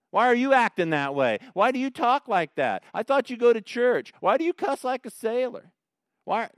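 loud, high rapid-fire questions; accusing unbeliever. Retold or imitated. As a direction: scold a churchgoer for cussing. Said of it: "The unbeliever always knows how we should act".

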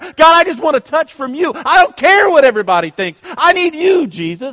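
high, loud impassioned plea; crying out. Acted. Worshipper calling to God despite onlookers, impassioned crying out. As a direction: cry out at full force. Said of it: "just cry out to God".

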